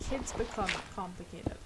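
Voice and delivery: ordinary talk, nothing marked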